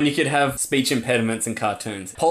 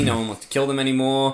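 usual speaking voice

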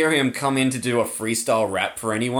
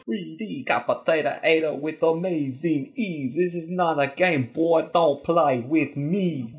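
slow, menacing South African rap voice; lightly voiced South African rapper; South African accent; 3 semitones higher, slower than usual. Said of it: "They got South African accents".

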